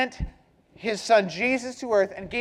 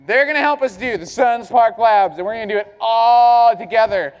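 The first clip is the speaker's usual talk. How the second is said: booming motivational speech; 3 semitones lower, 5 dB louder, faster than usual. Real, not acted. Hyping the crowd.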